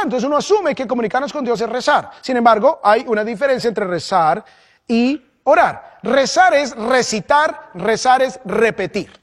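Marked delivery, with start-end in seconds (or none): 4.9-9.2 slow teaching. Shown as a demonstration. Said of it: "teaching the difference".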